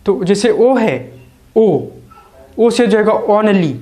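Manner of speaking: ordinary talk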